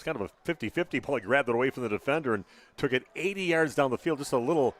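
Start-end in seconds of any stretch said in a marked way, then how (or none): 3.1-4.7 loud, higher live sports call; excited play-by-play. Real, not acted. Announcer calls the pop-up kick recovery, excited play-by-play. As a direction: raise it urgently for the loose ball.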